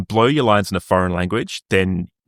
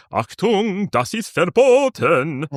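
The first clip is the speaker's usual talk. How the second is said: hammed-up German accent, higher and slow; mock German; 9 semitones higher, much slower than usual. Joking how to fake German on stage, mock German accent. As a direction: bark it like a film German guard, slow. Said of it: "So you just go".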